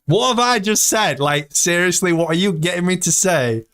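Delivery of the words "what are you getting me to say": usual speaking voice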